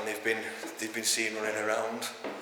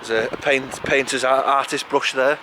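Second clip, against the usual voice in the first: raised, louder voice outdoors in wind; shouting over wind; 3 semitones higher, 5 dB louder than usual. Real, not acted. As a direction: talk loud over gusting wind. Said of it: "We can hear me over the wind".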